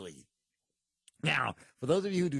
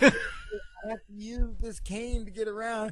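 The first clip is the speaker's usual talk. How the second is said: high, slow mock supplicant; afflicted churchgoer; 6 semitones higher, 4 dB louder, slower than usual. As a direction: drag it out like a pitiful pilgrim. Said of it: "Ernest Angley would take the cane".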